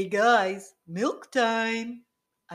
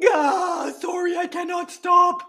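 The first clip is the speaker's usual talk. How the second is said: frantic shout; 8 semitones higher, 6 dB louder than usual. Acted. Character keeps snapping cookies.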